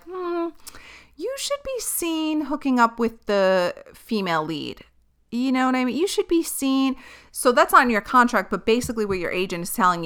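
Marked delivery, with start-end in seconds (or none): 0.0-4.8 suggestive agent. Acted. Agent nudging client toward the co-star, suggestive. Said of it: "if your agent is like". 6.0-7.0 a hint of a higher agent voice; lightly voiced agent. Agent hinting to client, lightly suggestive. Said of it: "if your agent is like".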